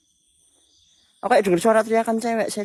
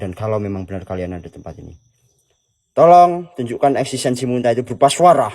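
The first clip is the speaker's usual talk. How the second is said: formal invocation; 9 semitones lower than usual. Acted. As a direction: address the spirits like a ritual. (seruan formal memanggil makhluk halus)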